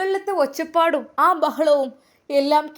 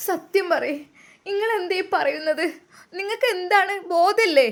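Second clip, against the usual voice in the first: sad and angry; 6 semitones higher than usual. Acted. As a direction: choke up, then snap back through the tears. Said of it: "ബുഷിക്ക് സങ്കടവും ദേഷ്യവും ഒരുമിച്ച് വന്നു". (കരച്ചിലോടെ ദേഷ്യം)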